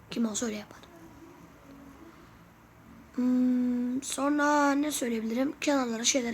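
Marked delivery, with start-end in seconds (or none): none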